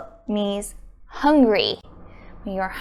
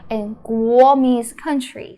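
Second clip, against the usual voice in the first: normal talking voice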